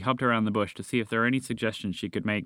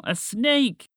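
high, raised, panicky voice; alarmed shout; 11 semitones higher, 3 dB louder than usual. A narrator's reading. Frog escaped from bush to his friend, shouting. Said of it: "he shouted at her".

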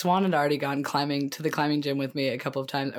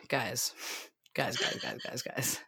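muttering; 4 semitones lower, 10 dB quieter, slower than usual. Real, not acted. Stuck for words, muttering.

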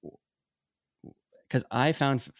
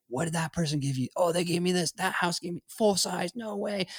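put-on high, thrilled kid voices comparing candy; excited trick-or-treaters; 9 semitones higher than usual. Kids swapping trick-or-treat candy, amazed and high. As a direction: play kids swapping Halloween loot, amazed. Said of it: "house to house and like".

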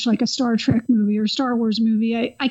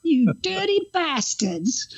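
mock Cockney; 4 semitones higher, slower than usual. Joins the Hal Cockney bit.

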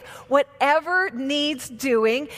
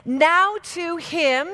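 slow, raised, word by word; staccato; 5 semitones higher, 3 dB louder, slower than usual. Shown as a demonstration. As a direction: call it out slow and high. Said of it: "say this".

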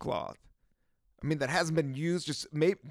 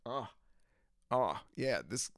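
usual speaking voice

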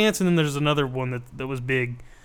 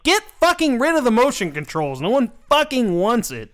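high, indignant comic rant; fed-up gamer protesting loudly; exasperated demand; 9 semitones higher, 3 dB louder than usual. Acted. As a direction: snap back in mock outrage. Acting angry reply to console makers.

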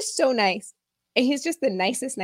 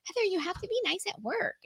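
friend's reply; 11 semitones higher, 9 dB quieter than usual. Retold or imitated.

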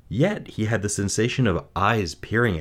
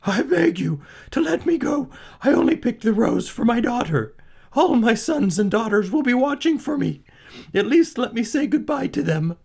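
very high, desperate pleading; frightened begging; 4 dB louder than usual. A narrator's reading. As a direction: plead with the beast for mercy. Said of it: "he begged".

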